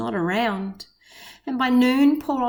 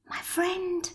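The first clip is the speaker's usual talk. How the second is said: a tiny far-off whisper; faint whisper; 7 semitones higher, 9 dB quieter than usual. A narrator's reading. Tiny Who greets Horton, faint whisper. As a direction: breathe it, barely there. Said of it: "The voice was so faint he could just barely hear it".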